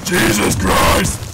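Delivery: real frustrated shout; furious shout. Real, not acted. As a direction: rage at teammates, full volume. Furious at teammates.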